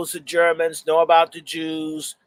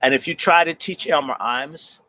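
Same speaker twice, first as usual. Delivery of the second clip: ordinary delivery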